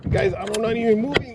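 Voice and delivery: high, loud disbelief; amazed. Real, not acted. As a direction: sound stunned and thrilled. Surprised strike while still.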